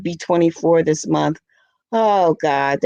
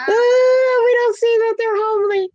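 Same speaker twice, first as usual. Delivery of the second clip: "homely" squealed much higher through laughter; cracking up. Real, not acted. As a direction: repeat the punchline, breaking into a high laugh. Repeats the joke, cracking up.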